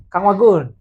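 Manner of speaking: sudden loud hail; calling out. Real, not acted. Calling the co-host by name.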